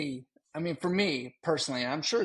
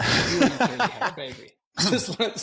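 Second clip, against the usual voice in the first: loud, higher laughing correction; 8 semitones higher, 7 dB louder than usual. Real, not acted. Friend correcting co-host about whose baby, laughing. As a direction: tease through real laughter.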